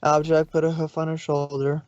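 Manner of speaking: ordinary delivery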